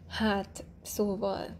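usual speaking voice

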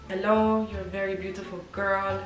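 put-on tentative stab at a Ukrainian accent; attempted Ukrainian accent. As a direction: try a Ukrainian accent, not quite landing it. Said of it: "I can't do the accent".

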